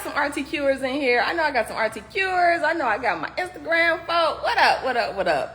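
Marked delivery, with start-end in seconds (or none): none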